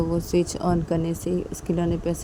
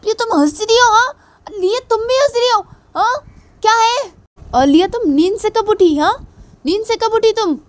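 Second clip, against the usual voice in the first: much higher and louder indignant shouting; angry scolding; 11 dB louder than usual. Acted. Mother scolds laughing husband and daughter, furious. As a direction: demand answers, outraged.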